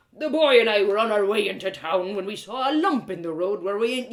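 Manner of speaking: put-on loud, rough old smith spinning a yarn; a hearty old man recounting the rescue; gruff blacksmith, storytelling.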